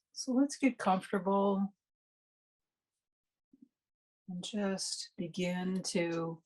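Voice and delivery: guided meditation. Real, not acted. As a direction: ease listeners unhurriedly into resting and noticing the breath.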